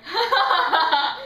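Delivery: loud, high mock laugh; big hammed-up ha-ha laughter; imitated laugh. Cheeky Peter Pan laughing at the line, imitated laugh.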